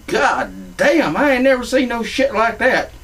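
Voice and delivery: high-pitched retold astonishment; amazed outburst. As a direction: exclaim it high in disbelief. Retelling his amazed outburst.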